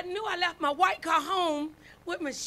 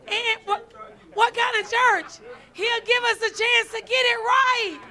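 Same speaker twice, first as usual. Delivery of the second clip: louder, slower fervent declaration; preaching; 4 semitones higher, 7 dB louder than usual. Acted. Preacher quoting her own answer to Jacob, loud fervent preaching. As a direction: preach it out to the congregation.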